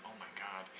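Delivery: very soft aside; quietly. Acted. Scientist to herself after Thor's reply on the dance floor, quietly. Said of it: "she quietly, she says".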